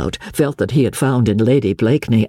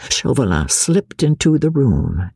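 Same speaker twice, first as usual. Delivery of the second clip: ordinary delivery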